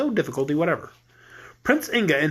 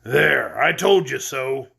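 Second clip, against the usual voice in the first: lower, cross goat voice; a goat snapping at clumsy porters; angry goat; 5 semitones lower, slower than usual. Acted. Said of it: "cried the voice angrily".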